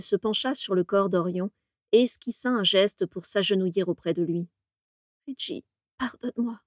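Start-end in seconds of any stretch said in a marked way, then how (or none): 5.3-6.6 much softer, small broken plea; tiny voice. A narrator's reading. Bellatrix begging her dead lover's forgiveness, tiny voice. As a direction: shrink the voice to almost nothing. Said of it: "dit-elle d'une toute petite voix".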